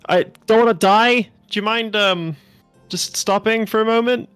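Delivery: pleading loudly, near panic; panicked plea. Acted. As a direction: beg them to stop in fear. Panicked passenger begging to stop.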